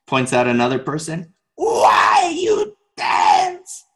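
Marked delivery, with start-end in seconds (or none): 1.6-3.8 very high, slow, stretched-out shout; hammed-up hotheaded teacher bellow, every word dragged; imitated yelling. Angry teacher challenges silent dancers, imitated drawn-out yelling. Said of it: "He just kept yelling".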